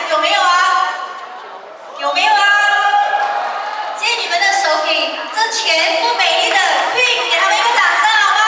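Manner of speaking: loud, high crowd-pumping call; rally the audience for applause; hyping up the crowd. Real, not acted.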